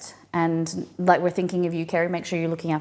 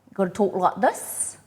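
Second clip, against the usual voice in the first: wide-mouthed South African twang on "talk like this"; South African accent demo. Shown as a demonstration. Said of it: "you gotta do this with your, your mouth".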